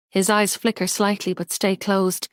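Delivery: plain reading voice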